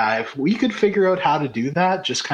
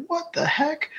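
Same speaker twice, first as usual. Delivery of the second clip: surprised; 12 semitones higher, 4 dB quieter than usual. Retold or imitated. Retold surprise at salamander.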